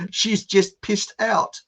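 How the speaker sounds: put-on slow, high comic French-accent vowels; bad French attempt. Quoting the comedy bloke, in slow, high, mangled French accent. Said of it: "bloke trying to speak French".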